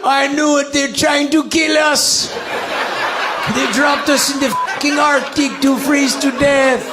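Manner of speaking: high, shouting hammed-up panic through laughter; panicked migrant. Migrants landing in the Arctic cold, high panicked mock shouting. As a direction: yell it like a terrified arrival in the cold.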